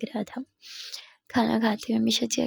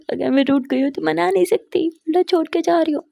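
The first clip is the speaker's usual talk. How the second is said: louder, teary protest; crying complaint; 5 semitones higher, 6 dB louder than usual. Acted. Jia, crying, scolds Misha for leaving: tearful complaint. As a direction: wail out the hurt, half sobbing. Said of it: "जिया ज़ोरों से रोने लगती है".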